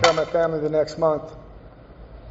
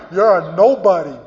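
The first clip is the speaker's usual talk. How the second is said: normal talking voice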